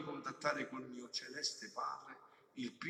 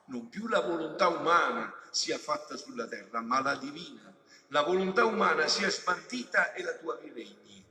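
louder, declaimed cry to the Father; impassioned preaching; 3 semitones higher, 11 dB louder than usual. Acted. Jesus crying out to the Father, loud preaching plea. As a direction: proclaim it as a plea to heaven.

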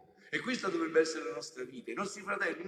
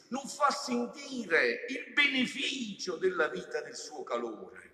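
impassioned preaching; 7 dB louder than usual. Real, not acted. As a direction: preach it at full force, stretching key phrases. Preaches at full force.

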